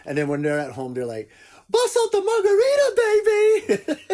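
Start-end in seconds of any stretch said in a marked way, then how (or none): 1.7-4.0 party whoop. Retold or imitated. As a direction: celebrate like the kids are gone. Parents partying at home.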